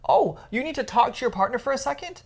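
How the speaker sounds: normal talking voice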